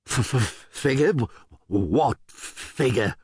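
slow, frightened stutter; stammering. Acted. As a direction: trip over each word in fear. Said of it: "started to stammer".

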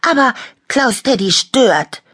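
high, loud complaint; high-pitched indignant whine; whiny protest. A narrator's reading. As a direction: object like a jealous child. Toy protests about the teddy.

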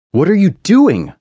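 high, loud alarmed outcry; crying out. A narrator's reading. Narrator protests as Oliver rams the locked gate, crying out. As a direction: cry it out, pitch leaping way up. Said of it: "I cried out".

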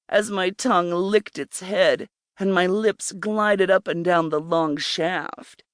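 ordinary delivery

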